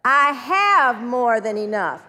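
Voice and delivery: slow, loud, emphatic declaration; forceful drawn-out affirmation; leading an affirmation. Shown as a demonstration. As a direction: proclaim it slowly, stretching "have".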